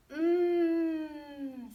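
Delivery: high, drawn out yummy hum; greedy mm. Acted. Goldilocks sniffing the chocolate pudding, humming with delight. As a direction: savor the smell out loud.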